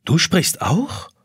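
put-on boy amazed the clock talks; surprised boy. A narrator's reading. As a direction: ask it wide-eyed, slowly. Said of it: "wundert sich Jonas".

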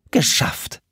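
breathless whispered cheer, puffing; out of breath. Acted. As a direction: gasp it out after hauling the slide. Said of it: "Sophie pustete". (atemlos gehaucht)

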